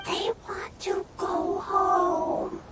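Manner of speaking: slow, soft whisper. Acted. Paimon quietly pitying the lost creatures, slow whisper. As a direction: breathe it out gently and slowly. Sad creatures, hushed remark.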